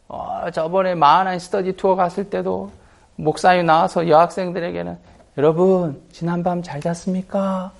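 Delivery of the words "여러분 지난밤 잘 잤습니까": slow, higher, sweet greeting; imitated gentle, drawn-out politeness; tender and caring. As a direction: greet the girls affectionately. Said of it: "아주 다정다감하게 얘기하고".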